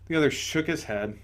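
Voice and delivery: ordinary delivery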